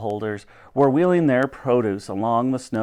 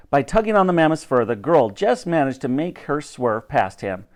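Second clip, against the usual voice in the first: usual speaking voice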